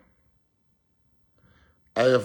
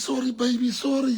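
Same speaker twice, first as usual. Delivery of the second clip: high, whiny mock apology; pleading husband; 11 semitones higher than usual. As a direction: beg like a caught cheater. Acted cheating husband begging forgiveness.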